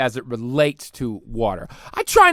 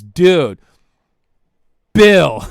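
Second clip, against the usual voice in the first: pitch guy; 9 dB louder than usual. Retold or imitated. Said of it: "a dude goes".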